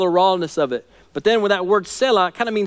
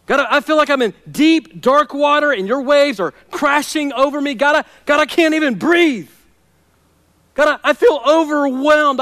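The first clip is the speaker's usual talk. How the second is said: preaching a despairing prayer, higher and louder; anguished prayer; 8 semitones higher, 4 dB louder than usual. Acted. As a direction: cry out to God as a man who feels dead. Voices drowning psalmist's prayer.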